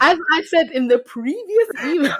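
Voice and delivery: high, loud polite-but-pointed; overly polite, barely hiding annoyance; passive-aggressive work voice. Retold or imitated. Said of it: "work voice in the email".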